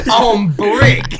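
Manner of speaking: shouted through a laugh. Real, not acted. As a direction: yell it while cracking up. Laughing echo of joke.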